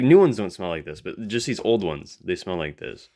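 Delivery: ordinary delivery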